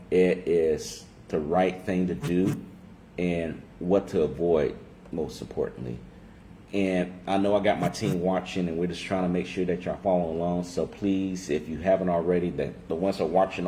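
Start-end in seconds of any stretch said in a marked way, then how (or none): none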